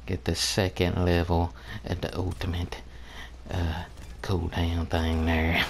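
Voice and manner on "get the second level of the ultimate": ordinary talk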